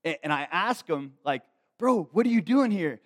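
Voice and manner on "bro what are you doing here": high, loud amazed "what are you doing here"; shocked to find him there; stunned joyful greeting to a friend; surprised greeting. Retold or imitated. Surprised to see Josh again.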